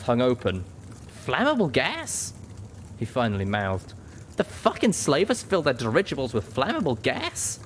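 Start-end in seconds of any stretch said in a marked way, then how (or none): 1.2-2.3 high, slow, incredulous question; astonished. A narrator's reading. 4.4-7.6 high-pitched incredulous outburst; can't believe what you're seeing; astonished. A narrator's reading.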